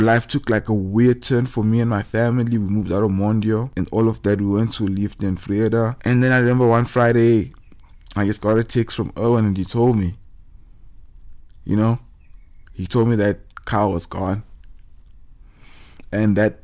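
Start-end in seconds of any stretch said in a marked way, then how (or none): none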